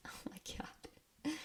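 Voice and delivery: hushed, voiceless whisper; real whispered shock. Real, not acted. Host reacting to a ghostly reply, whispered. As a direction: whisper it slowly under your breath.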